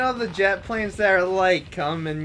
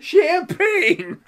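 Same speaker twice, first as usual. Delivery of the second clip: laughing; 9 semitones higher than usual. Real, not acted. Reading name mid-laughter.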